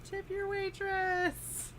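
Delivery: high, long drawn-out little call; faint distant cry. Acted. Frog knight's head shoved into the bag of holding, faint high cry. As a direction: call out thinly, stretching every word. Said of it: "a faint voice cries out".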